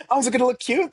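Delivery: imitating a clerk thrilled to make a gift cute; Tara excited. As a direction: chirp it like a delighted gift wrapper. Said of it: "She's like".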